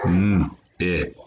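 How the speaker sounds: "n-ip" broken into separate sounds; tapped out. Shown as a demonstration. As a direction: hold the n, then the rest of "n-ip". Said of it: "let's tap it out first".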